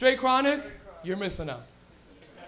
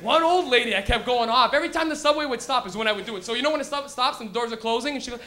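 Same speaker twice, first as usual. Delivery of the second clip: ordinary talk, nothing marked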